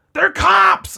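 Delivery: very high, loud alarmed yell; kids yelling. Retold or imitated. Kids on bikes spotting the car, high loud yell. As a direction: scream the warning and bolt. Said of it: "And they just go".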